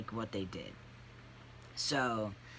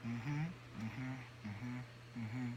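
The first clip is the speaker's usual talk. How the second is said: nodding therapist; 10 dB quieter, much slower than usual. Retold or imitated. Mocking counselors who nod while you talk, absent murmur. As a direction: imitate a therapist's automatic quiet mm-hmms. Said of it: "learning how to nod your head".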